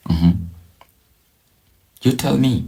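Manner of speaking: usual speaking voice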